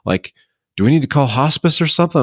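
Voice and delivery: high, fast alarmed nurse; freaking out. Retold or imitated. Nurse startled by wide-awake patient, high panicked rush. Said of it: "the nurse kind of freaks out".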